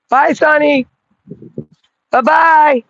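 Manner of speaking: high lilting bye-bye; high cooing farewell to the pet; sing-song goodbye to dog. Real, not acted. Waving the dog off.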